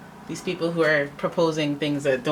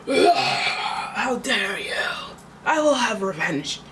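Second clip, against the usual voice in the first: breathy hammed-up hiss, then a higher theatrical threat; mock dramatic vow; 4 semitones higher, much slower than usual. Mocking a dramatic querent vowing revenge, hammed-up. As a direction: play a melodramatic villain swearing revenge. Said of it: "you're just super dramatic".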